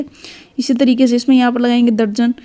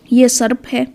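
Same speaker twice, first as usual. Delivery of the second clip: normal talking voice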